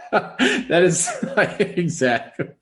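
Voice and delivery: laughing through the words, high and loud; slow, helpless laughter breaking up the sentence; cracking up. Real, not acted. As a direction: talk while losing it laughing. Cracking up over a meme.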